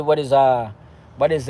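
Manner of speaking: normal talking voice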